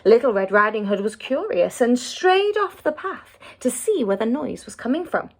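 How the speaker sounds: ordinary delivery, nothing marked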